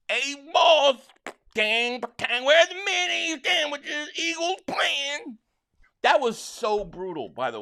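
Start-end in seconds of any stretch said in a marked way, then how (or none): 0.1-5.4 high, drawn-out rural Kansas farmer voice, slow to the end; hammed-up country parent whining; Superman parents accent. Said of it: "Superman parents accent".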